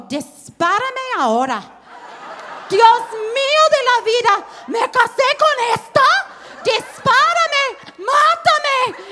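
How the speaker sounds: high, loud laughing despair; laughing old father. Retold or imitated. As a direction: beg for death, horrified and joking. Papá con demencia ve a mamá. (el papá horrorizado al ver a su esposa, riendo)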